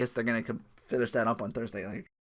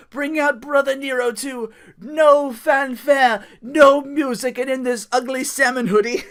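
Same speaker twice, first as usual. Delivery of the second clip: much higher, louder, slow grand decree; pompous command; 12 semitones higher, 10 dB louder, slower than usual. Retold or imitated. Mimicking Matt Hardy summoning his brother, slow grand decree. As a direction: declare it like a deranged king.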